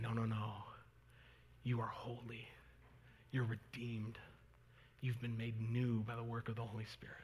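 soft, tender near-whisper of comfort; a hushed voice telling the church they are holy; whispered reassurance. Retold or imitated. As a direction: whisper it like reassuring someone close. Paul voiced affirming the church.

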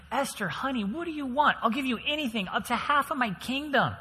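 high, indulgent royal voice; king sweetly promising his wife anything; doting king. Acted. King voiced offering Esther anything.

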